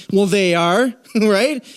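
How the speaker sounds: normal talking voice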